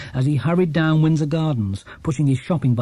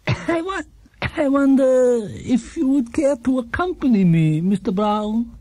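put-on higher, gentle old man's voice; kindly old shopkeeper; 9 semitones higher, slower than usual. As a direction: play a cheerful elderly shopkeeper. Gruber invites Paddington.

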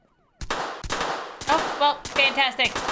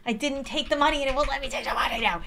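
ordinary delivery

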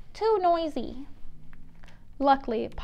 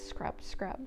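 whispered scrub scrub; 6 semitones lower, 11 dB quieter than usual. Acted. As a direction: breathe it out softly. Whispered song refrain.

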